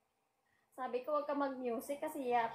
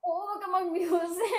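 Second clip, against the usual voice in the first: louder and higher, fed up; exasperated insistence; 5 semitones higher, 9 dB louder than usual. Real, not acted. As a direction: insist with raised voice. Repeating the same request, exasperated. (naiinis na paggigiit)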